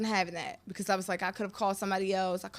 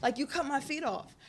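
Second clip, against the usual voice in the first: mock Russian accent, higher than usual; 4 semitones higher, 4 dB quieter than usual. Imitating the husband griping about photos, light Russian accent. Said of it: "in his Russian accent".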